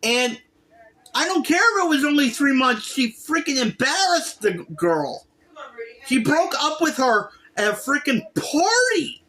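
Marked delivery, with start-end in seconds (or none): none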